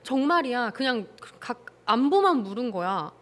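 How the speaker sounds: ordinary talk, nothing marked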